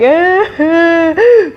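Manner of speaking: high crying whimper; a child's wail breaking out; retold sob. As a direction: sob before the words. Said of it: "I started crying".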